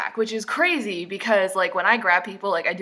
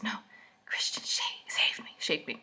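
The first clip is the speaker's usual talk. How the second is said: hushed, voiceless stumbling; whisper; 10 dB quieter than usual. Acted. Heroine tells Kate of her rescue, whispered. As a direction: whisper it, fumbling the word. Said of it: "She whispers".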